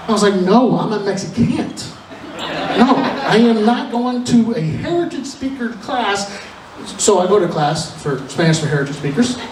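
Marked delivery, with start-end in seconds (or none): 0.4-6.3 slow, high-pitched indignant refusal; mock-outraged, drawn-out objection; emphatic refusal. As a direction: put your foot down, word by word. Acted refusal to the adviser.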